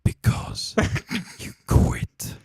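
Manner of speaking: "Because you quit" in a breathy, unvoiced gladiator whisper; hushed, grand gladiator delivery of "Because you quit". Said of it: "in your gladiator voice".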